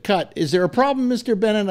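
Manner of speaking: lightly mimicked, high-voiced director; session director. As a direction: call a halt, then query the actor. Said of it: "and the person said".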